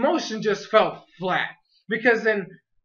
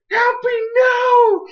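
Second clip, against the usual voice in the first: high, loud, crying scream of a name; anguished crying shout; 7 dB louder, much slower than usual. Retold or imitated. Reviewer reliving his scream as Happy flies away, crying shout. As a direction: cry out in despair as the cat flies off. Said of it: "And I'm like".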